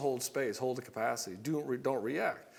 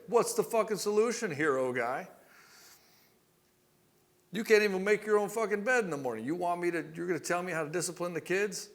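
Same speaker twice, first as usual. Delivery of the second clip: higher-pitched, scornful mock tirade; spicy wife; 5 semitones higher, 3 dB louder than usual. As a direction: challenge the husband like an angry wife. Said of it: "the fact that she gets spicy".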